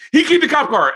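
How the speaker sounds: high, loud panicked blurt; snitching on his friend in a high panic. Retold or imitated. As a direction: blurt it out like a scared kid. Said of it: "I folded so quick".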